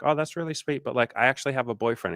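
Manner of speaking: lifted, sweet imitation of a woman; polite excuse. Imitating a woman telling her suitor she is taken, apologetic. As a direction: decline kindly, quickening on the excuse.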